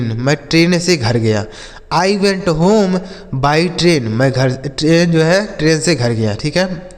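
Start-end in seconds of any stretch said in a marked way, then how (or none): 1.9-4.0 drawn out word by word; slow dictation voice; very slow model sentence. Shown as a demonstration. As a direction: read it slowly for children. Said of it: "teacher's slow model sentence".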